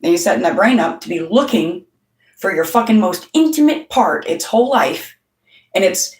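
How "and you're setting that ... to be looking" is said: ordinary delivery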